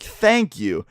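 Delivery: high, emphatic mock relief; retold vindicated thanks. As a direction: act out finally being believed. Vindicated reply to mom, retold.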